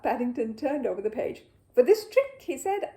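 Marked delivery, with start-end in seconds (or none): 1.7-2.4 higher, louder showman opening; grand announcer bear. A narrator's reading. Bear announcing his magic show to the family, grand and showy. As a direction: announce it like a proud little magician.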